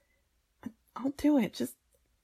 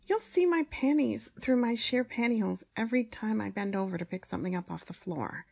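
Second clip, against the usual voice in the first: ordinary talk, nothing marked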